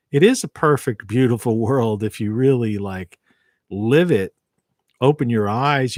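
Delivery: usual speaking voice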